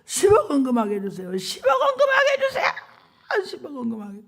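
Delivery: sobbing, breaking into a high shout; wailing prayer. Retold or imitated. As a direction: wail the plea through tears. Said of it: "막 울면서 통곡이 나면서". (통곡하며 울부짖는 기도)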